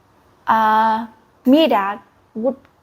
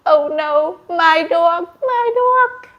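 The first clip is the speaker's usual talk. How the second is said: mock crying; 6 semitones higher than usual.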